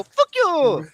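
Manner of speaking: high yelled fuck you; mock Japan yell. Voicing Japan yelling at censors. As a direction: scream it as Japan, indignant. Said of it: "And they go".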